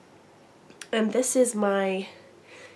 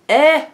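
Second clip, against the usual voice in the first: tired exasperated sigh; weary sigh; 7 semitones higher, 12 dB louder than usual. Real, not acted. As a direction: let out a fed-up sigh. Sigh at lingering cough.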